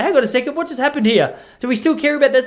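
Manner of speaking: mock puzzled bystander. Mock confused bystander quote.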